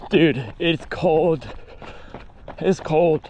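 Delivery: slow shivering voice; shivering while running. Real, not acted. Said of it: "I'm shivering".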